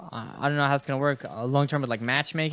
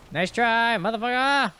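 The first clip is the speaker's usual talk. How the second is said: mock taunt; 7 semitones higher than usual. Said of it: "I'll be like".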